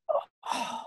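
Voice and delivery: breathless, voiceless "oh, oh, what?"; whispered disbelief; stunned whisper. Retold or imitated. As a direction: caught off guard, barely a breath. Stunned by harsh peer feedback.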